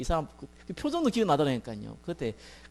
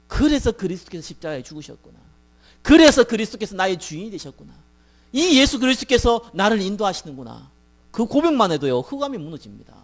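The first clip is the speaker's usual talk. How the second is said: raised, loud confession of faith; fervent preaching; 5 semitones higher, 8 dB louder than usual. Real, not acted. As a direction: proclaim it with building force.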